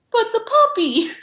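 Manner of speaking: high, pleading mock whine. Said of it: "I was just like".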